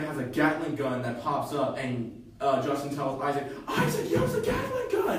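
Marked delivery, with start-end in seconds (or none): 3.7-5.1 much higher, loud mock yell; a hammed-up panicked yell from the fleeing Jeep; excited shout. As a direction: squeal it out in disbelief. Justin yells during car chase.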